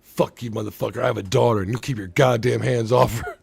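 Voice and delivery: lightly voiced defiant father; tough dad comeback. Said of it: "the way he delivers it".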